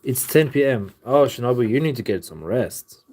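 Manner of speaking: ordinary talk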